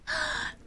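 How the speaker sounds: sharp high delighted gasp; a quick, excited intake of breath. Real, not acted. Thanked for pushing toward likes.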